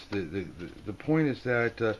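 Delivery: normal talking voice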